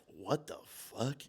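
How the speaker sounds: slow stunned mutter of disbelief; baffled disbelief. Retold or imitated. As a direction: drag it out, totally baffled. Bewildered reaction to neighbor's texts.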